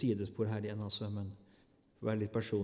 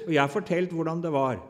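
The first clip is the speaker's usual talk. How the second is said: booming declaration; 3 semitones higher, 7 dB louder than usual. Acted. God answers Job, booming declaration. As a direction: declare it with full force from the pulpit.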